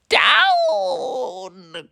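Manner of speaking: very high shrieking witch shout. A narrator's reading. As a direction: yell the command down high. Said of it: "cried the witch".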